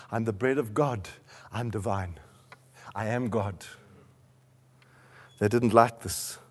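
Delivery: low, soft, solemn sermon voice; hushed preaching. Real, not acted. Preaches in lowered, measured voice.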